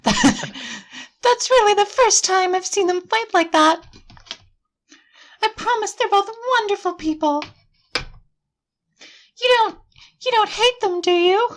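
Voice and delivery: put-on high-pitched game-girl voice; Sayori, anxious and reassuring. Game girl defending her quarrelling friends, high and anxious. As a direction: plead for your friends in a high girlish voice.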